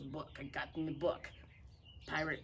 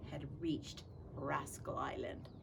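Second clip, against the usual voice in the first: whisper; 3 semitones lower, 6 dB quieter than usual. A narrator's reading. Whispered narration.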